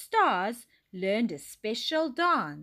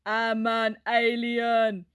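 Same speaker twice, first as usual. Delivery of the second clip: slow, wobbly silly alien; wibbly alien voice; 4 dB louder, slower than usual. A narrator's reading. Dylan jiggles his lip to amuse classmates, wibbly alien voice. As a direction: wobble it out slowly like a daft alien. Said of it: "he wibbled".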